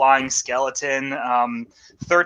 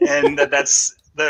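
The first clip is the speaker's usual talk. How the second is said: ordinary delivery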